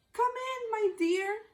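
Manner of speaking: ordinary delivery, nothing marked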